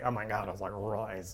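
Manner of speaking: put-on low, very soft garbled teen reply; quiet run-together mumble; mumbling schoolkid. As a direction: answer like a kid nobody can understand. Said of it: "She'll need a translation box".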